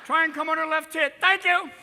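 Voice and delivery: high and loud, flat reading of script with "Line" and "Thank you"; an actor blanking and calling for lines; actor reading with line calls. Retold or imitated. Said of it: "the guy's going".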